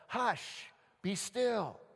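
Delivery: high, firm command; commanding the storm. Acted. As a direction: order a raging storm into silence. Jesus commanding the raging storm.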